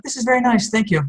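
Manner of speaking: high, politely pleased imitation; high-pitched corporate politeness; mock corporate thanks. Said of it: "And they're like".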